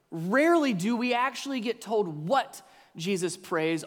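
ordinary talk

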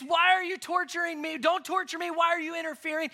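high, pleading demon voice. Retold or imitated. Preacher voicing the demon begging Jesus, high plea. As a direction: beg like the cornered demon. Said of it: "falls flat on his face".

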